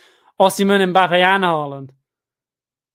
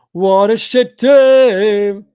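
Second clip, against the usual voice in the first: drawn-out yell; 3 semitones higher, 12 dB louder, much slower than usual. Real, not acted. Frustrated fan repeats it louder.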